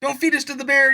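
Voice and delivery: high, loud panicked begging; screaming pleas. Retold or imitated. Thieves dragged behind a cave bear beg for mercy, screaming high and loud. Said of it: "They're screaming the whole way".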